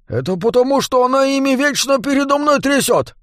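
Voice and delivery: high, defensive gnome voice; defensive dwarf. A narrator's reading. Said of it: "защищался Дворф".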